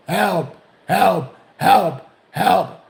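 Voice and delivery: mock old man's yell; screaming for help. Old man on the floor refuses to get up, mock screaming. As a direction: call out each cry loudly, hammed up. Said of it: "started screaming".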